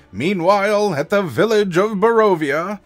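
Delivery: high, loud comic-book narrator; announcer voice. Shown as a demonstration. DM cuts to the other group, loud announcer voice. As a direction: boom out the scene change like an announcer. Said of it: "I'm using this announcer voice".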